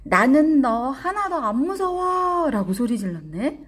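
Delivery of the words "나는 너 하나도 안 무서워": high, loud, drawn-out storybook shout; acted child's brave yell; defiant yell. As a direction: shout it slowly and bravely, pitched way up. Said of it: "어떻게 소리 질렀어요?".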